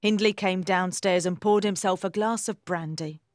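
ordinary delivery, nothing marked

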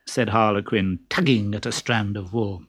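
usual speaking voice

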